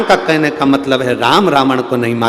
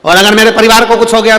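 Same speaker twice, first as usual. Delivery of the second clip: fast, higher worried pleading; anxious quick plea; 6 semitones higher, 7 dB louder, faster than usual. Retold or imitated. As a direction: rush it out, fretting about family. Disciple anxious, guru brushing off. (घबराया हुआ तेज़ आग्रह)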